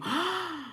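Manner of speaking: a high, soft gasp; shocked gasp. Real, not acted. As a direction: gasp in disbelief. Shocked by the story.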